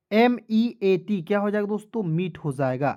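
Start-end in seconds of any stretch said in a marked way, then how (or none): 0.1-1.2 very slow letter by letter spelling. Shown as a demonstration. Teacher spelling out heat to students, very slow. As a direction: drag each letter for the class. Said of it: "teacher spells slowly for class".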